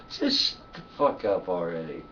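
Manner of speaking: normal talking voice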